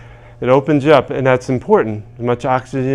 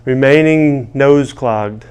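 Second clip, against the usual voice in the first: emphatic affirmation lead; slower than usual. Shown as a demonstration. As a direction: chant each phrase firmly for them to repeat. Leads call-and-response tapping affirmations.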